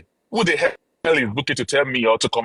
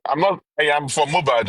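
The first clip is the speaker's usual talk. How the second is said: ordinary delivery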